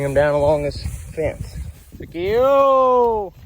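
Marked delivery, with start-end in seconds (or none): 2.0-3.3 long high hollering cattle call. Real, not acted. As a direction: holler out across the pasture. Calling cows to come.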